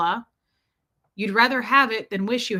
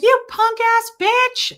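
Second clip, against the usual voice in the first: taunting insult; 13 semitones higher, 8 dB louder, slower than usual. Retold or imitated. Taunting a screaming driver.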